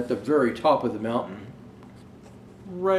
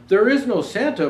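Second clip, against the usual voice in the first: insisting friend; 8 semitones higher, 7 dB louder than usual. Acted. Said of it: "my friend had insisted".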